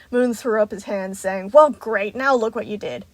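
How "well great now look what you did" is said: angry blame. A narrator's reading. Moon blames Roxy.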